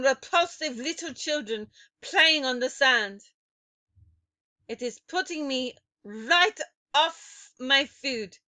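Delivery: put-on loud, high, accented witch rant; screaming witch. A narrator's reading. Grand High Witch demanding why children remain, screaming. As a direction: scream at the crowd in disgust. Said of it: "she screamed".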